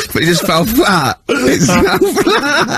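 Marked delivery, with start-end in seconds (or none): 1.3-2.8 laughing. Real, not acted. Laughing at his own story.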